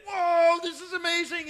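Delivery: high, loud whoop of pure joy; ecstatic yell. Retold or imitated. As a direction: shout it as the big fish fights you. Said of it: "he's yelling".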